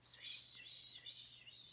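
soft, breathy sword swishes; whispered swishes. Acted. Storyteller whispering the sword's swish sounds.

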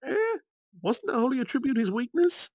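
very high, puzzled squeak of a fairy; a tiny fairy blurting out her confusion; high fairy voice rising in disbelief; surprised fairy girl. Acted. Ramiris asks in surprise.

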